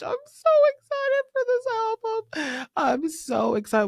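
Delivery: high-pitched squeal, welling up with excitement; teary excitement. Real, not acted. Fan gushing over [name]'s new album, teary excitement. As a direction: squeal it out, on the verge of happy tears. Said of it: "Why am I getting teary-eyed?".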